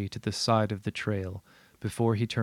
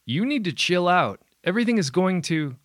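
loud, high, brushing it off; raised dismissive voice; 9 semitones higher, 6 dB louder than usual. Acted. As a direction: snap back loud and high.

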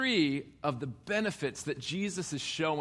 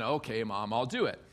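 mock grudging consent; 7 semitones lower than usual. Preacher playing Jesus giving in to Mom, mock grudging. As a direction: give in reluctantly, voice dropping. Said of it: "Mary twists his arm".

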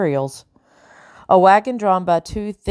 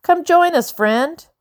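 mock-friendly hail; 11 semitones higher, 4 dB louder, slower than usual. A narrator's reading. Bellingham hailing Lockton.

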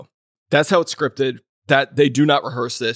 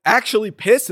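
excited; 8 semitones higher, 6 dB louder than usual. Real, not acted. Excited retelling of anger.

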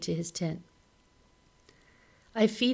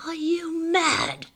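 shout; 11 semitones higher, 5 dB louder, slower than usual. A narrator's reading. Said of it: "he shouts".

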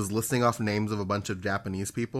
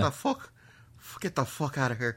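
puffed-out mock Irishman; breathless Irish accent; 4 semitones higher than usual. Improvising an audition line for a friend, breathless Irish accent. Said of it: "sounds like you're out of breath when you say".

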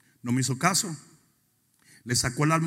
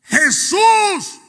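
much higher, loud proclaiming sermon; ferviente predicación; 10 semitones higher, 8 dB louder than usual. Acted. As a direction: proclaim Christ's titles at full force, much higher. Preaching at full force. (proclamación a todo pulmón, mucho más aguda)

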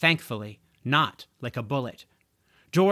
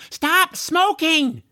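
loud high yell, drawn out slowly; 14 semitones higher, 6 dB louder, slower than usual. Acted. George yells at the smokers to stop. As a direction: shout a warning across the alley. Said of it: "he yelled".

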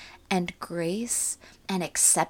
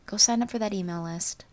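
ordinary delivery

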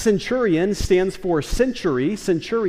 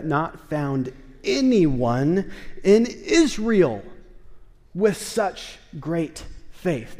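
slow marvel; much slower than usual. Acted. Jesus marvels at centurion's faith.

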